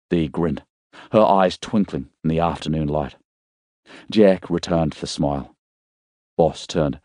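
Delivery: ordinary delivery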